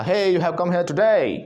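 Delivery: American drawn-out call-out; American accent. Retold or imitated. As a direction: imitate an American driver squaring up for a fight. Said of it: "आगे वाला American था।".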